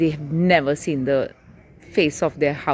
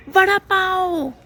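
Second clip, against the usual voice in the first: put-on high, slow, eager child crying out for a snack; a kid spotting his favorite food; excited kid; 12 semitones higher, 3 dB louder, slower than usual. A narrator's reading. Boy in the car to Ma, high eager cry. Putti begging in read story.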